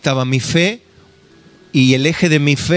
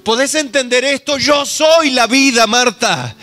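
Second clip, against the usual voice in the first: higher, earnest appeal to Marta; pleading preaching; 9 semitones higher than usual. Acted. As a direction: urge her to believe, raising the voice high. Preaching Jesus' words to Marta.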